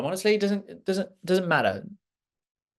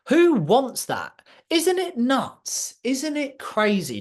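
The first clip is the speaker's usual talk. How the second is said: slow, much higher, drawn-out disbelief; incredulous disbelief; 7 semitones higher, slower than usual. Real, not acted. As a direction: let each question hang in amazement. Incredulous rhetorical questions to audience.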